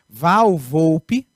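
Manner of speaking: usual speaking voice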